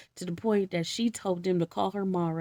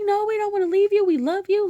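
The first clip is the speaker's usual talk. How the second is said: mock crying plea; 12 semitones higher, 6 dB louder than usual. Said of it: "daughter-in-laws was crying".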